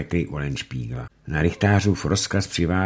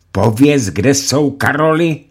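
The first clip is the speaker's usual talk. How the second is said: high, loud pressing demand; coaxing judge; 8 semitones higher, 9 dB louder than usual. Acted. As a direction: press him for the answer. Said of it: "řekl rychtář".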